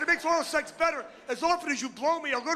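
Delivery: sarcastic high repeat of the wife's words; mocking echo. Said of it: "I said".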